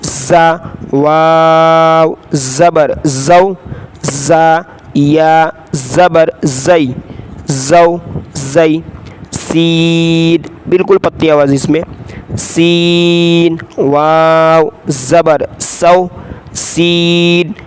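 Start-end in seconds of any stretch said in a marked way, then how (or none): 0.0-10.4 Qaida spelling drill. Shown as a demonstration. 12.4-17.5 Qaida spelling drill. Shown as a demonstration.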